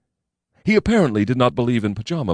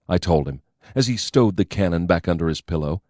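plain reading voice

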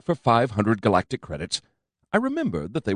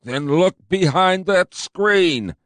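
slow, higher, louder blubbery cop; fat cop blurbling; 9 semitones higher, 4 dB louder, much slower than usual. A narrator's reading. Fat commander telling his partner where to look, blurbling. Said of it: "the fat cop blurbled".

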